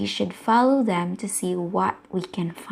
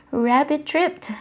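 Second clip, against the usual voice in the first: usual speaking voice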